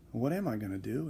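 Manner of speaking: softly retold inner question; musing to himself. Teacher wondering how to handle a banging student, musing softly. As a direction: wonder quietly, as if thinking aloud.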